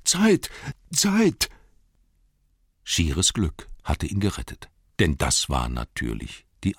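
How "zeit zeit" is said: a panicked, high squeal of the word; sudden shriek. A narrator's reading. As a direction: shriek it, much higher and louder. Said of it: "plötzlichen Aufkreischen". (plötzliches, hohes Aufkreischen)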